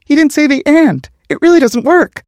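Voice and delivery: very high, whiny falsetto; high-pitched whine. A narrator's reading. Ramirez objecting that it doesn't work, high-pitched whine. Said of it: "her high-pitched whine".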